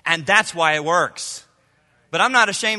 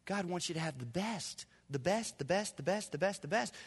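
tender reassurance; 13 dB quieter than usual. Real, not acted.

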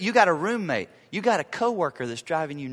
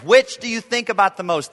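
usual speaking voice

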